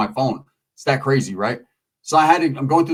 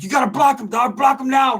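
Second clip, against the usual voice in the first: mock screaming; 9 semitones higher than usual. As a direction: bark orders at a buddy, imitating. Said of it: "he was screaming at his homeboy".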